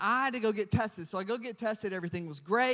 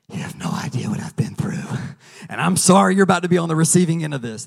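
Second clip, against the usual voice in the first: mock threat; 2 semitones lower, 11 dB louder than usual. Husband joking he threatened the lab lady, mock menace. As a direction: pretend to unload on her. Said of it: "No, I was really sweet to her".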